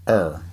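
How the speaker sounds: "er" said like "air" in a Southern drawl; Southern er for our. Shown as a demonstration. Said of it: "Instead of our".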